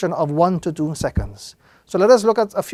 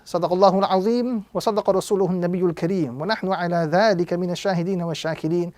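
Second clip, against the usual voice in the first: quick, softer recited Arabic closing; fast closing formula; 5 dB quieter, faster than usual. Acted. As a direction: rattle off the familiar closing blessing.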